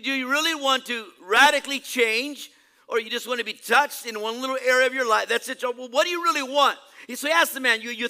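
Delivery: high, loud, insistent questioning; forceful preaching. Real, not acted. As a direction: press each question on the congregation.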